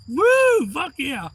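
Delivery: high, loud celebratory holler; elated spectator yell; excited whoop. Retold or imitated. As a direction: whoop and cheer, reliving it. Reliving own cheering.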